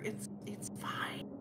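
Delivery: soft unvoiced whisper. Real, not acted. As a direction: whisper it, slow and hushed. Whispered reassurance at dark joke.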